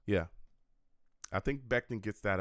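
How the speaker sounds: ordinary talk, nothing marked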